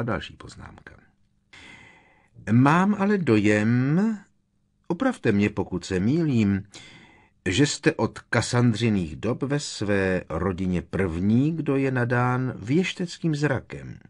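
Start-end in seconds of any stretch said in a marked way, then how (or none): 2.5-14.0 lightly voiced inspector, higher and louder; a touch of officious, drawn-out politeness; probing inspector. Professor questioning a colleague about her family gift, probing.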